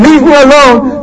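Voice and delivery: hammed-up tyrant demanding; Pharaoh blustering. Pharaoh to his court, mock bluster. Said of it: "But he's saying".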